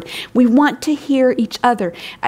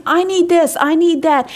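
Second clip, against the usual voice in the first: mock whine; 6 semitones higher than usual. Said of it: "How often we whine".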